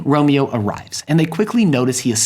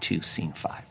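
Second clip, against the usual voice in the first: breathy whispered aside; 7 semitones lower, 11 dB quieter than usual. Real, not acted. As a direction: drop to a whisper. Closing scene announcement, dropped.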